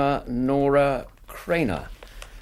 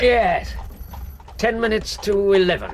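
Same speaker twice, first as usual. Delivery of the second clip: raised voice; 7 semitones higher, 4 dB louder than usual. Acted. Answering over cab noise.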